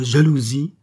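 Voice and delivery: "jalousie" given firm emphasis; stress on JALOUSIE. A narrator's reading. The queen confesses to Charny, stressing "jalousie". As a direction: lean hard on the word "jalousie". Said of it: "Elle appuya sur le mot jalousie".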